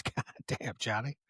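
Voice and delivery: soft, breathless awed whisper; whispered aside. Retold or imitated. Curly Bill whispering to Johnny in awe. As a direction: hush it like you can't believe he said it.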